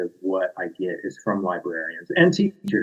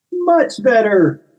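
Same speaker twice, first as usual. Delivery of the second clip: high, slow, satisfied; pleased unicorn; 13 semitones higher, 4 dB louder, slower than usual. Acted. Unicorn approves new wording, high slow smug voice. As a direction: savor the approval.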